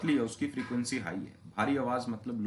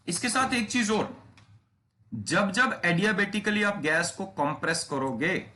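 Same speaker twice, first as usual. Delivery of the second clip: higher, louder new point; emphatic teacher introducing a key fact; 6 semitones higher, 4 dB louder than usual. Real, not acted. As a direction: announce an important extra point.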